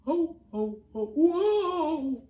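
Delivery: loud rising whoa; alarmed whoa. Acted. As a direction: ham up the crash. Crash about to happen.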